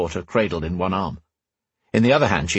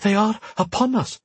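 airy, breathless urgent voice; out of breath; 13 semitones higher than usual. Acted. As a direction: gasp it out, winded and airy. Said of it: "took on an out-of-breath airiness".

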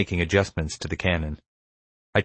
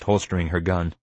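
usual speaking voice

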